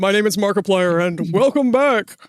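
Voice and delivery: lightly deepened YouTuber intro; a hint of Markiplier's voice. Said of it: "try to do Markiplier's actual intro".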